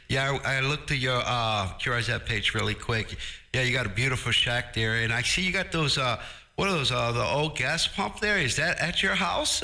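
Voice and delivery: ordinary talk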